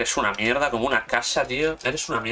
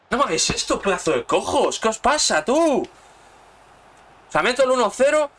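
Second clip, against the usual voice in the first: much higher and louder, exasperated shouting; angry yelling; 10 semitones higher, 4 dB louder than usual. Real, not acted. Gamer shouting at his collapsing team, furious. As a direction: cry out in disbelief at your team. Gamer raging as lead slips.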